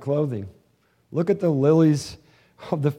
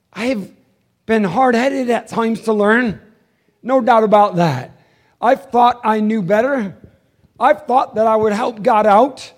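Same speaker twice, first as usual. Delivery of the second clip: loud, raised preacher's confession; full-force preaching; 8 semitones higher, 9 dB louder than usual. Acted. Pastor confessing to the church, loud fervent preaching.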